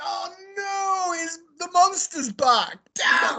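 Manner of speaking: high, drawn-out comic dismay; mock panic. Retelling the moment the monster returned, mock panic. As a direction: wail it in hammed-up dread.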